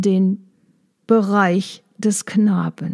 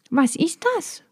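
higher, softer little-bee voice, anxiously rising; a timid, worried ask; anxious question; 8 semitones higher, 4 dB quieter than usual. Acted. Maya asks Schnuck what the pocket is: anxious. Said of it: "fragte Maya ängstlich".

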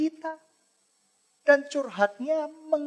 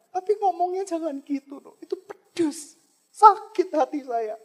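higher, quick hurt reproach; a hint of a wounded wife pleading; Hana telling her rival she is hurt, a touch of hurt; mildly hurt complaint; 6 semitones higher, 5 dB louder than usual. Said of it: "Hana berusaha membereskan dengan madunya".

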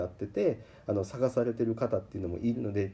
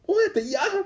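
sulking bike voice; 8 dB louder than usual. Retold or imitated. The bike fears being sold, whimpering very high and loud. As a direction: plead in a tiny wounded voice. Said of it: "拗ねたんや".

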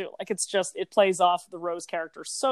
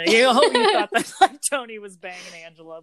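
laughing mock realization; 8 dB louder than usual. Mock viewer realization quote, laughing.

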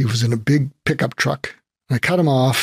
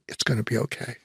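soft reassurance; 10 dB quieter, faster than usual. Retold or imitated. Trucker comforts him hand on shoulder.

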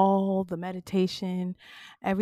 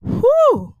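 shaky exhale; 9 dB louder than usual. Real, not acted. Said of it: "I'm about to start crying now".

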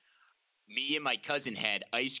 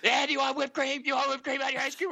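high, loud, fast tipsy granny voice; drunk grandma voice; 5 dB louder, faster than usual. Retold or imitated. Grandma pushing whipped cream on the grandkids, high tipsy voice. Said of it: "Say it like that".

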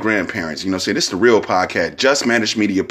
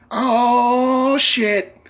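mock surprise. Quoted startled reaction.

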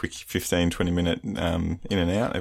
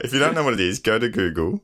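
ordinary talk

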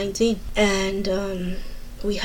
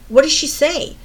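retold sharp question; 5 semitones higher, 6 dB louder than usual. Retold eager question to brother.

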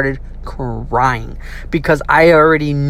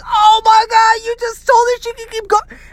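very high, squealed disbelief; mock horror. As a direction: act out a horrified sibling, voice leaping up high. Retold horror at brother's defiance.

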